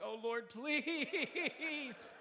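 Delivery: drawn-out pleading prayer, very slow; comic stretched plea to God; mock desperate prayer. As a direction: beg the Lord, stretched and slow. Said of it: "I'm like".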